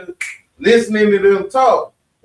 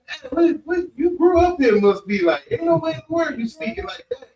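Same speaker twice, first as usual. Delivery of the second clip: high, incredulous retold voice, close to crying out; higher-pitched disbelieving quote of strangers; astonished Chinese onlookers; 9 semitones higher than usual. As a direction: imitate shocked locals who can't believe her Chinese. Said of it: "the Chinese people gotta ask her".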